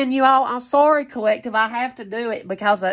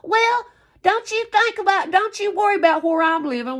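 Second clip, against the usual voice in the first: much higher sneering put-on voice; mocking whiny rival; 9 semitones higher than usual. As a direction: squeak her defensive reply mockingly. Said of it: "Don't say".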